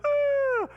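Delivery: put-on short high yelp of a child who got bumped; kid's quick cry. Shown as a demonstration. A child hit by a buddy, a quick high yelp. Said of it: "there's the cry that you do".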